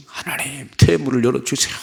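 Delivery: imitated pleading cry to God; crying out in prayer. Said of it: "막 부르짖었겠지요".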